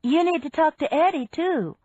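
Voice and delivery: high-pitched call back. A narrator's reading. Wife answering the major from the boat, calling out. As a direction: raise the pitch to call it across. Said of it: "Laura called".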